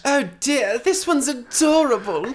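high, gushing put-on voice; hammed-up delighted rich parent; doting adoptive parent. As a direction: coo over an adorable find.

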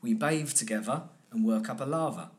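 London accent, th turned to v in "bathe together"; easy London sound, "bathe" said as "bave". Shown as a demonstration. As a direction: demonstrate a London accent through the mouth shape. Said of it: "Just change that th to a v".

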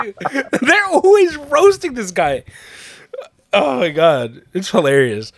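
high, slow words through laughter; can barely get it out for laughing. Real, not acted. Retelling the roast to a friend, laughing hard.

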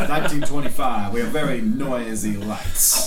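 laughing excuse. Real, not acted. GM laughing at his own slip.

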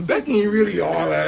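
high laughing mock exclaim; retold reaction. Retelling his change of heart, laughing high. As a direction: laugh as he takes it back. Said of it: "I was like".

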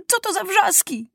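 angry shout. A narrator's reading. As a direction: scold the noisy servants. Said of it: "krzyknął".

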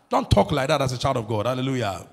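usual speaking voice

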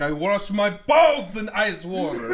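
slow, loud, hammed-up Russian accent. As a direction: boom it out in a thick Russian accent. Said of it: "this terrible Russian accent".

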